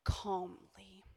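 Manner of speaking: soft whisper on calmly. Shown as a demonstration. Pastor showing calm patience, whispered. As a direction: breathe the word out calmly. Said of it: "You can do it".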